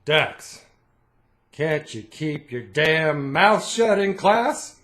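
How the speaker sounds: mock angry dad. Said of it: "his response would always be".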